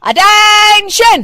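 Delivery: high, drawn-out slow parade-ground shout; mother-in-law calling the house to attention; drill command. Acted. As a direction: bark it like a drill sergeant. Retired policewoman barks command.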